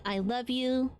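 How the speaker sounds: ordinary talk, nothing marked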